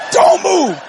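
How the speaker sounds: high, firm warning; imitated wife's warning. Wife repeats warning to husband, imitated high warning. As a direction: order him to stay frozen.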